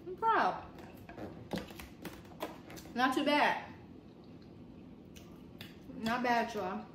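ordinary delivery

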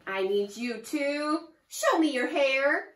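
ordinary delivery

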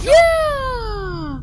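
long high whoop falling away; triumphant yell. Real, not acted. Player jumping onto the truck, triumphant yell. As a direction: holler it out in triumph.

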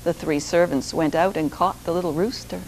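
ordinary delivery, nothing marked

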